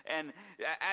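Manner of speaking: ordinary delivery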